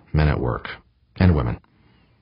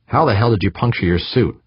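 raised-pitch, sharp questioning; sharp-edged demand; 6 semitones higher than usual. A narrator's reading. Woman to worker with punctured suit, sharp-edged demand. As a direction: demand an answer with an edge. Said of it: "woman's sharp-edged voice".

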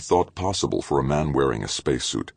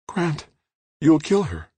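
much higher, alarmed warning; frightened plea; 14 semitones higher than usual. A narrator's reading. Trudy warning Grant, much higher and frightened. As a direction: afraid of what he'll do.

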